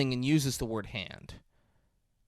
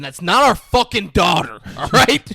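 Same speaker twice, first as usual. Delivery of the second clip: louder, indignant protest; angry guest; 2 semitones higher, 8 dB louder than usual. Retold or imitated.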